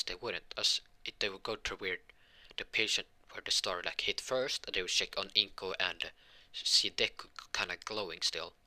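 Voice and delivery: usual speaking voice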